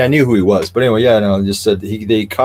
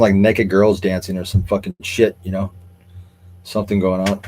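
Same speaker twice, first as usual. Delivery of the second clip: ordinary talk, nothing marked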